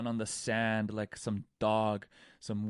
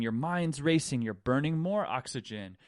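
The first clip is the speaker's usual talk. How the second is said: usual speaking voice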